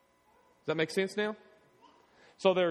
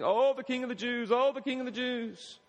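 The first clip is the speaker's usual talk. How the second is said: mocking; 6 semitones higher than usual.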